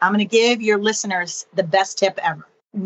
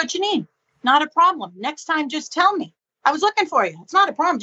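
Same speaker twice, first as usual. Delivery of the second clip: higher-pitched acted reassurance; mock patient husband, pitched up; reassuring partner; 6 semitones higher than usual. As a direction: lightly imitate an easygoing partner insisting it's fine. Acts out partner reassuring her.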